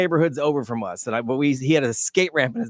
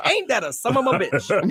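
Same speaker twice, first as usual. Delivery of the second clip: high-pitched mock exclamation; own catchphrase; 7 semitones higher than usual. Co-host quotes himself on about anything, amused exclamation. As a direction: say it as your pet phrase, pitched up. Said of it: "'Cause I always say".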